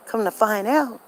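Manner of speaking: ordinary delivery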